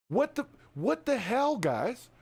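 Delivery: slow stunned what the hell; baffled. Real, not acted. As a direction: hang on the words in confusion. Baffled outburst at media.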